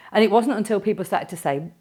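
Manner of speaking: ordinary talk, nothing marked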